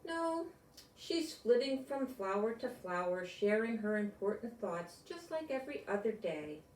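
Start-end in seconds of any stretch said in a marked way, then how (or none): none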